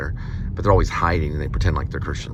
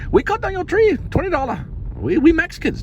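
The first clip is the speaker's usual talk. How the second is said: mock Chinese accent; 5 dB louder than usual. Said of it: "Chinese people pretending like they're Mexicans".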